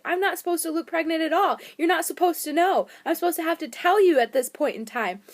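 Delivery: high, loud mock upset; imitating a hurt, emotional complaint; retold tearful protest. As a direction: plead your case like an overwrought wife. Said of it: "I busted into tears".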